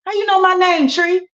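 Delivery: put-on high, startled kid; shocked. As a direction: jump up in pitch, caught off guard. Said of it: "Johnny shocked".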